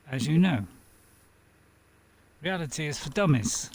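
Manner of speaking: ordinary talk, nothing marked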